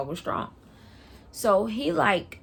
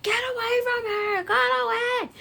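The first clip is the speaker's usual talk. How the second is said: imitated shrieking bystanders, pitched way up; high frantic mock scream; retold screaming. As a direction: scream like rescuers chasing someone off. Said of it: "they screaming".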